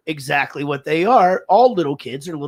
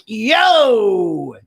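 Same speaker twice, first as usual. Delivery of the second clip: high, drawn-out "yo" greeting; acted hearty greeting; 6 semitones higher, slower than usual. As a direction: play up a big hello to an acquaintance. Acted reply to acquaintance.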